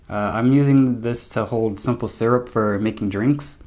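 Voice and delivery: ordinary talk, nothing marked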